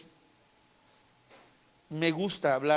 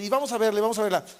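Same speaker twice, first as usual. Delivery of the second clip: higher-pitched, quick insistent pestering; stubborn nagging; 5 semitones higher, 3 dB louder, much faster than usual. Retold or imitated. Wife pestering husband daily to see the house, stubborn nagging. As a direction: keep badgering, voice pitched up. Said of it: "terca ya todos los días".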